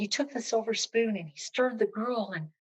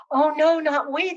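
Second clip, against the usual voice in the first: excited servants; 7 semitones higher, 7 dB louder than usual. Acted. Servants bursting in, house in uproar.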